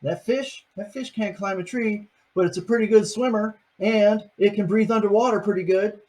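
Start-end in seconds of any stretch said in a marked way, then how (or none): none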